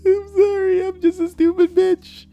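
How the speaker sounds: very high whiny apologetic voice; hammed-up high pleading voice; groveling mock sob. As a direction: whimper apologies in a squeaky put-on voice. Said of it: "And she's like".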